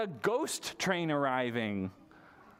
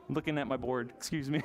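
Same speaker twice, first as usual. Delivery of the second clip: ordinary talk, nothing marked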